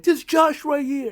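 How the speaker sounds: high, slow, exaggerated crooning; mock tender cradling. Jimmy cradling Jey's head, high slow mock crooning. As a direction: coo it like cradling an infant. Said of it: "he's like".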